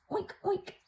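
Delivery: high, squeaky mock oinking; pig oinks. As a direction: oink like a silly piglet. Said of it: "And the pig says".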